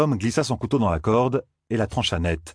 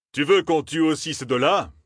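a higher, projected, theatrical threat; projected stage voice; 8 semitones higher than usual. A narrator's reading. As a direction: project it like an actor. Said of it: "sa voix amplifiée comme lors d'une représentation".

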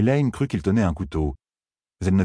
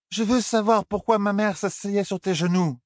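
high, pushy slurred demand; insistent drunk Elaine; 14 semitones higher than usual. Acted. Elaine demanding Tom explain her mother, high and slurred. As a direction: press him for the truth, tipsy.

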